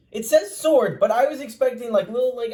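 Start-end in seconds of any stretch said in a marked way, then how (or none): none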